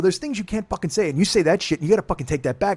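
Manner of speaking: loud, high, heated shout; angry yelling. Retold or imitated. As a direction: demand she take it back, yelling. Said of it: "and I start yelling".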